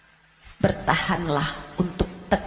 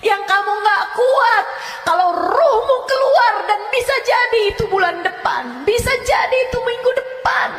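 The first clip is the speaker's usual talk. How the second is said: loud, higher warning sermon; fiery preaching; 11 semitones higher, 6 dB louder than usual. Acted. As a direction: thunder the warning at the congregation. Preaches of the grave's torment. (ceramah berapi-api dengan suara lantang)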